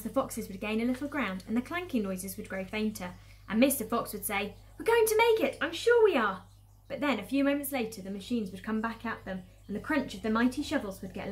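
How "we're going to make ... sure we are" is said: high, loud, hopeful encouragement; rallying cry. Acted. Mister Fox reassuring the digging foxes, rallying cry. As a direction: cheer them on with raised pitch and volume. Mr Fox rallies his family in story.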